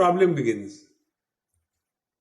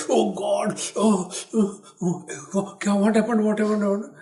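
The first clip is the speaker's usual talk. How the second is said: mock distress; 5 semitones higher than usual. Faking distress for attention.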